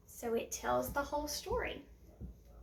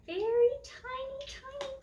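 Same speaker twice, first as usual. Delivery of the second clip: much higher on tiny tiny; 12 semitones higher than usual. Shown as a demonstration. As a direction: squeeze the voice small for tiny. Describing smallest doll.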